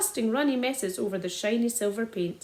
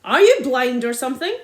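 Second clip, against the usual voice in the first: louder, scornful demand; angry retort; 9 dB louder than usual. A narrator's reading. Angry landowner mocking the father, raised voice. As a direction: sneer it at them loudly. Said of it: "shouted Mister Hazel".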